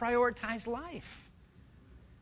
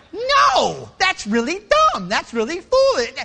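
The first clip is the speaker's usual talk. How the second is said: high, very loud squeaky rebuke; little voice scolding; 8 semitones higher, 13 dB louder, slower than usual. Acted. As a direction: shriek it as a tiny conscience.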